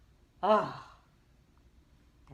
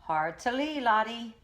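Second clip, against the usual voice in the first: louder, higher captain's order; sailor call; 6 semitones higher, 7 dB louder than usual. A narrator's reading. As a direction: bark the sailing order. Sailor command in boat.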